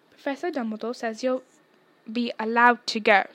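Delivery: ordinary delivery, nothing marked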